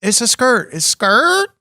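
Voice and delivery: high imitated tire screech; car noise. Shown as a demonstration. Explaining kid slang to a friend, car noise. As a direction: mimic car tires skidding. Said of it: "it's just fucking car noises".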